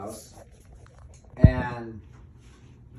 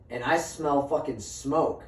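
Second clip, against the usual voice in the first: ordinary delivery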